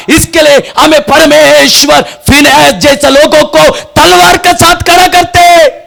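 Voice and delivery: high, loud, stretched preacher's cry; full-force preaching. Acted. Preacher rousing the church about sin, full force. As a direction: thunder it at the congregation.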